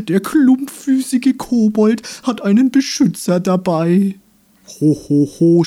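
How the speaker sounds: high, loud dandelion taunt; mock the little protector; jeering dandelion. Dandelion jeers. (höhnischer Löwenzahn, hoch und laut)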